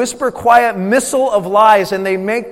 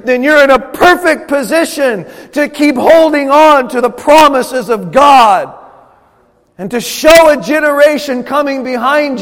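higher, louder, driving sermon voice; rally the congregation with rising fire; full-force preaching; 6 semitones higher, 8 dB louder than usual. Acted.

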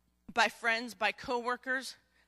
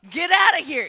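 loud, higher shout; forceful command; 5 semitones higher, 14 dB louder than usual. Acted. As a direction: push them away hard. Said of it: "And she's like".

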